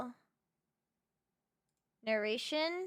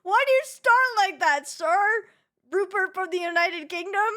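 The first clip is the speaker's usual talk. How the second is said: laughing; 8 semitones higher, 12 dB louder than usual. Real, not acted. Cracking up at Rupert voice.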